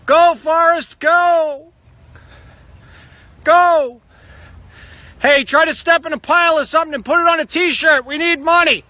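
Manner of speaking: high, loud holler into the distance; full-voiced high yelling; calling out. Real, not acted. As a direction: shout it across to someone far off. Yelling to kids running away.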